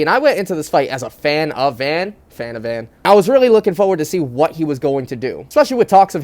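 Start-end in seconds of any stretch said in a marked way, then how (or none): 2.3-2.9 dropped to a murmur; quiet aside. Real, not acted. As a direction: mutter it to yourself. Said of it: "quiet rhyme aside".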